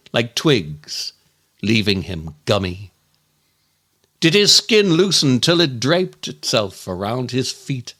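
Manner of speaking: ordinary delivery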